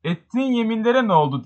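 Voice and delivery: high, urgent cry of accusation; understated outcry, pitched high. A narrator's reading. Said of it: "diye haykırdı redingotlu delikanlı".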